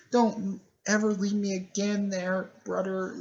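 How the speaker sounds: put-on high, pleading girl voice; lightly acted sister, high and earnest; little sister. Girl pleading with her brother.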